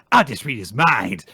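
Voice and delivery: light, punchy little-girl impression; lightly voiced Anya. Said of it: "And Anya's like".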